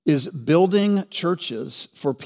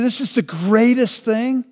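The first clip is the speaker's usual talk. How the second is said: higher, louder mock cheering; excited crowd; 7 semitones higher, 4 dB louder than usual. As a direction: gush like a delighted crowd. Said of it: "They say".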